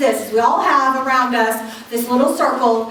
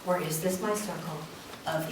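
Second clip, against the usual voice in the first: soft self-questioning; 8 semitones lower, 10 dB quieter than usual. Shown as a demonstration. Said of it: "we're gonna ask the question".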